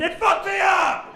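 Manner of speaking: hammed-up shouted complaint; comic shout. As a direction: blow up in mock outrage. Comic outburst about trauma.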